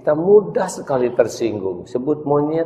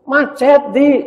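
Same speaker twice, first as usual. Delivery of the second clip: put-on much higher, louder kid; a little boy answering, high; high child voice; 6 dB louder, slower than usual. Friend tells Adi why he is late, high child voice.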